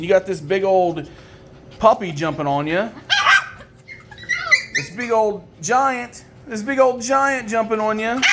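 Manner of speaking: usual speaking voice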